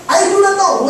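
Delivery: a touch of rude denial; lying denial. Acted. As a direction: brush God off with a lie.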